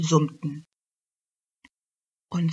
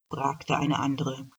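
ordinary delivery

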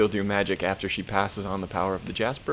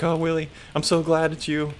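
higher-pitched imitated relief; mock relief; 7 semitones higher than usual. Girl greeting her grandmother at the door, mock relief.

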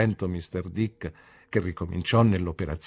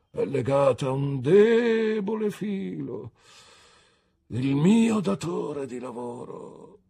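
higher, slow, weighty; theatrical Micawber; 8 semitones higher, 3 dB louder, slower than usual. A narrator's reading. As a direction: lean on each word portentously. Micawber's dramatic reply. (Micawber, teatrale e lento)

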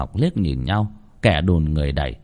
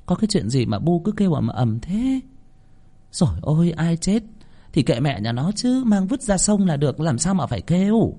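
higher-pitched petulant grumbling; sulky, disgruntled; 7 semitones higher than usual. Acted. Daughter coming out, annoyed by mother's shouting, sulky. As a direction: complain crossly in a high young-mistress voice. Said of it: "đi ra hậm hực".

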